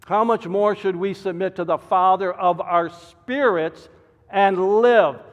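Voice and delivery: loud, high, driving pulpit delivery; preaching at full force. Acted. Pastor warning his congregation, preaching at full force. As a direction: hammer the warning home from the pulpit.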